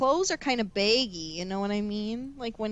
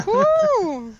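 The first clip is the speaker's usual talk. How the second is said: high, loud whoop of delight; excited whoop; 13 semitones higher, 8 dB louder than usual. Real, not acted. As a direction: cheer like a fan. Fangirling over Human Shrek.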